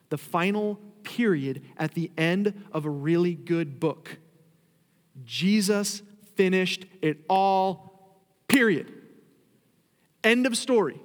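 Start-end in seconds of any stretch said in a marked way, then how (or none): 5.2-11.0 loud, higher preacher's proclamation; forceful preaching. Acted.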